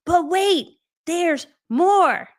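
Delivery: loud, high infomercial announcer hook; infomercial hype. Pretend. Host teasing viewers with a pitchman's hype. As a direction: hit it like a late-night TV pitchman. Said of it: "That's my favorite thing to say".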